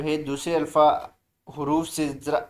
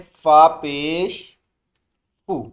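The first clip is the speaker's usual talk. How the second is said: slow, loud drilled syllables; teacher's loud slow recitation; 5 dB louder, slower than usual. Shown as a demonstration. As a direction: call it out loud for the class. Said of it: "बुलंद आवाज़ से".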